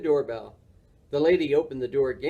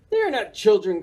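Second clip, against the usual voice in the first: higher, mildly alarmed storybook cry; 6 semitones higher, 3 dB louder than usual. A narrator's reading. Morris sees the trick-or-treaters, mildly alarmed cry. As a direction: protest with a touch of alarm. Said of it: "cried Morris".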